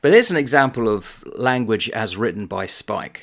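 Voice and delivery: ordinary talk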